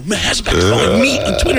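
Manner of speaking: fast, high, insistent "me", rising at the end; excited confirmation. Retold or imitated. Comedian confirming to friend it's "me", excited confirmation. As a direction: confirm it's "me" in a giddy rush.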